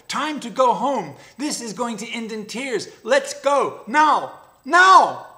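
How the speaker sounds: from urgent high pleading building to a full high shout; yapping panic. Acted. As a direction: panic mounting until he screams "now". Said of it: "yapped the jackal".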